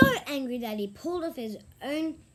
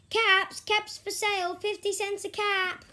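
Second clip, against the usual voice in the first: much higher and louder street call; calling out wares; 10 semitones higher, 7 dB louder than usual. Acted. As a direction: sing out like a street peddler. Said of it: "he walked back to town calling".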